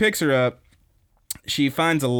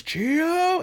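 high-pitched drawn-out yell; imitated attacking yell; mock battle cry. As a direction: cry the name out high, without real volume. Retold yell with raised fist.

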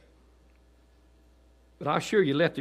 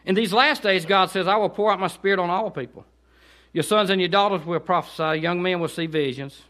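raised preaching read; preaching scripture; 5 semitones higher, 6 dB louder than usual. Acted. Preacher reading scripture to the congregation, fervent. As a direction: proclaim the verses with fervor.

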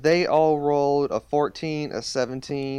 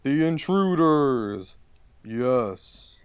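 talking tree. Acted. DM voicing the tree.

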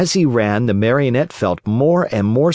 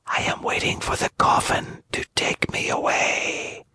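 put-on barely audible, like a dying girl; weak whisper; 7 dB quieter than usual. A narrator's reading. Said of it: "she whispered".

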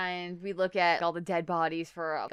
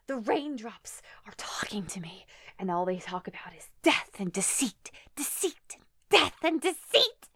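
dramatic diary reading. Retold or imitated. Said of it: "like, reading it".

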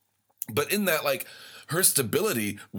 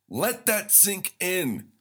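slow and dramatic; 8 semitones higher, much slower than usual. Acted. Dramatic pause for effect.

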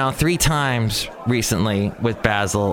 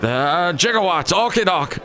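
higher, put-on movie character voice; mock Back to the Future voice; 5 semitones higher than usual. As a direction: toss out film catchphrases in character. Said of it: "Back to the Future".

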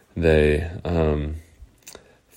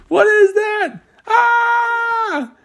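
high, loud shriek; terrified scream; 4 dB louder, much slower than usual. Acted. Girls scream as the slime creature moves. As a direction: scream at the moving thing. Slimy thing moves in dark.

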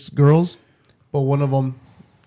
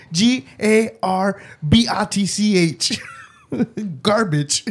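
slow, higher spelling, breaking into laughs; Beetlejuice; 5 semitones higher, slower than usual. Acted. Answering a spelling quiz, slow character voice. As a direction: spell it out laboriously in a put-on voice. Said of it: "Fucking Beetlejuice".

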